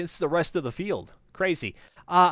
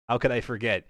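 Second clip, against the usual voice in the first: usual speaking voice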